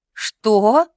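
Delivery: loud, astonished question. A narrator's reading. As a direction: burst out in disbelief. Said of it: "У девушки от удивления". (изумлённо, громко)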